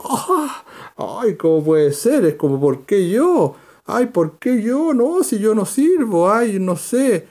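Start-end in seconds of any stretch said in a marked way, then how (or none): 0.0-2.2 mock disbelieving wife; Sara incredulous. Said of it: "Sara se ríe con incredulidad". 2.6-7.3 high whiny self-doubting wife voice; higher, fretful mock wife; Sara doubting herself. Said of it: "Así estaba Sara".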